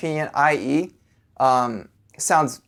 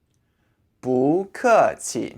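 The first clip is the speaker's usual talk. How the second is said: slow, separated syllables; slow demonstration; much slower than usual. Shown as a demonstration. Teacher introducing the first phrase for "you're welcome", slow demonstration. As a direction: draw out each syllable for learners. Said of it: "the first phrase for".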